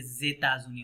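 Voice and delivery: জেতাজুনি beginning "zay", z on the vowel; liaison z. Shown as a demonstration. Teacher concluding the liaison rule, জেতাজুনি with z at the front. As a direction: start জেতাজুনি with the linked z. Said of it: "এর সাথে জ লাগলে কি হবে".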